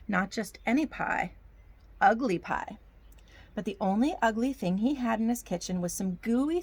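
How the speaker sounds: put-on storybook narration in a folksy Southern accent; hammy Southern storytelling accent. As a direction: read it like a Southern yarn for children. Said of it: "I get a Southern accent".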